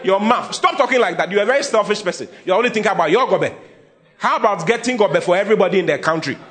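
preacher rebuking selfish talk loudly; scolding rebuke. Acted. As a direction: tell them off loudly, half laughing. Preacher scolding congregation playfully.

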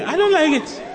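very high, giggly fake refusal; flirty mock protest. Shown as a demonstration. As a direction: play coy, laughing through the no. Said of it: "you are going".

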